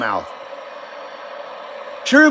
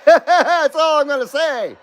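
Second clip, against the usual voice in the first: slow, higher, through a laugh; laughing coy repeat; 6 semitones higher than usual. Real, not acted. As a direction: tease them with a giggling repeat.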